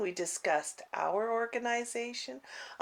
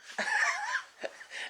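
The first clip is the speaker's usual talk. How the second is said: a sudden squealing laugh, much higher; burst of high laughter. Real, not acted. Telling viewers about the lunch invite, high squealing laugh.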